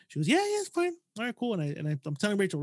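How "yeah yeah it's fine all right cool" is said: a breezy, higher mock of the aunt saying yes; tossing off an easy yes, pitched up; lightly voiced aunt. Nephew retelling his aunt's easy okay, lightly mimicked.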